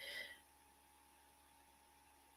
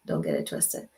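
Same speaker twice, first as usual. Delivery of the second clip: much lower and softer, "Don't get it twisted" growled down; low sassy warning; 8 dB quieter than usual. Real, not acted. Repeat dropped much lower.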